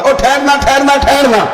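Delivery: high, urgent call to stop. Pretend. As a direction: call from behind to halt someone. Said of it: "پیچھے سے کوئی دیکھنے والا آواز دے".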